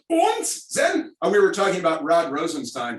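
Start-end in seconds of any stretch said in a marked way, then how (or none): 0.1-1.1 a high, shouted German "und"; mock German accent. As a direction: toss in a jokey German "und". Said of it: "we all grew up with the German accents".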